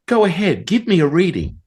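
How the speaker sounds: high, punchy mock challenge; challenging dare. Teacher challenges the student.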